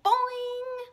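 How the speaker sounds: high loud playful boing; high cartoon boing yell. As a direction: yell it while tweaking a curl. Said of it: "yelled a muffled".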